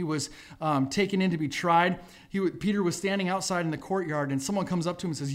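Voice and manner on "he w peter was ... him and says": ordinary talk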